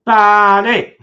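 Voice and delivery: sudden high, loud shout; shouted name. Real, not acted. Calling to someone in the chat, high loud shout.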